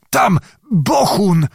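high, alarmed warning; terrified astonishment. A narrator's reading. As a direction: blurt it out as if you had seen death. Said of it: "malował się przestrach w połączeniu z największym zdumieniem".

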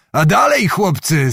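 high, rousing call; rally the lads with a high shout. A narrator's reading. Zagłoba urging the Cossacks on to drink, calling out. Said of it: "zawołał".

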